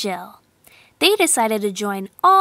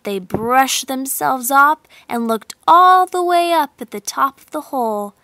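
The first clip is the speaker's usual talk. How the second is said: plain reading voice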